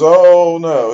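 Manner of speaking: loud hammed-up "oh no"; mock alarm. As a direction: play up the fake shock. Warden feigns alarm at trout.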